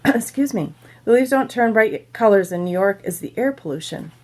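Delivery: plain reading voice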